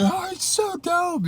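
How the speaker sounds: squealed way up high, slowed and drawn out; excited scream. Retold or imitated. Teens in the back cheering the driver's move, imitated squeal. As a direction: shriek it like giddy teens.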